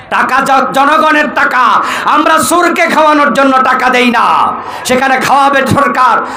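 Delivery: high, driving sermon in short bursts; rally the crowd with insistent high phrases; full-force preaching. Real, not acted. Preacher tells crowd not to fund thieves, full-force preaching.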